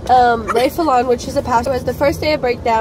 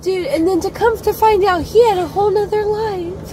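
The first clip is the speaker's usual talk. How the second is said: higher, sing-song Disney storyteller voice; fairy-tale telling; 6 semitones higher than usual. Acted. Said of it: "Like a Disney chose".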